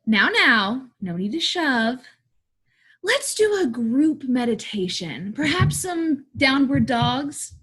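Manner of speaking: forced sweetness with swooping pitch; coaxing drama teacher trying to keep order; strained patience. Acted. Young drama teacher soothing rowdy kids, strained patience. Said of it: "she is annoyed by the kids".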